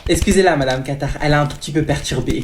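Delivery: loud, overbearing mom voice; loud pushy mother; loud mother character. Acted. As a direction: play the pushy mother booming over everyone.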